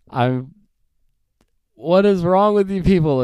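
loud, high reenacted rant at the landlord; exasperated outburst. Retelling the landlord refusing internet wiring, loud indignant outcry. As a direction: throw up your hands and yell it, still furious in the retelling. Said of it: "I was so mad".

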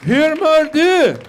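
high, loud, slow greeting; showy Swedish greeting. Acted. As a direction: belt out the Swedish slowly. Shows off his only Swedish greeting.

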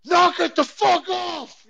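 drawn-out furious shout; yelled. Retold or imitated. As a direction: bellow it down the road at the neighbours. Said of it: "and I yell".

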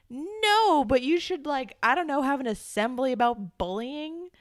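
loud, high, exasperated objection; exasperated protest. Real, not acted. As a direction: start on a long high wail of no, then argue on. Arguing back at principal's response.

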